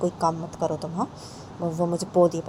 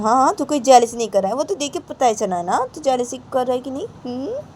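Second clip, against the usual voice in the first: higher, louder mocking jab; loud taunt; 7 semitones higher, 7 dB louder than usual. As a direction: rub it in, loudly. Uncle taunting father.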